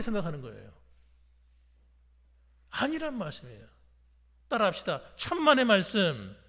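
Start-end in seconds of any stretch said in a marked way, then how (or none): 5.2-6.4 loud, slow, drawn-out call; slow emphatic prompt to repeat. Real, not acted. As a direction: lead the congregation firmly, stretching it out. Leads congregation to repeat after him.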